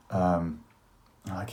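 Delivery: ordinary delivery, nothing marked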